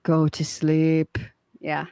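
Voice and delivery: slow soothing lullaby hush; whispering over a crib. Pretend. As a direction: hush him to sleep gently. Putting him to sleep.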